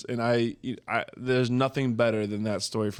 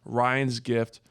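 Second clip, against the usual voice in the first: normal talking voice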